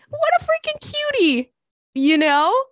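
high, gushing squeal; squealing with delight at a cute stranger. Real, not acted. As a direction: coo over how cute she is. Gushing over the young girl.

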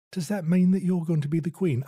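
put-on light Cockney lilt on a child's question; Cockney accent. Sister asking in a mock Cockney accent. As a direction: ask it like a little London street kid. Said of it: "I don't know why she would have a Cockney accent".

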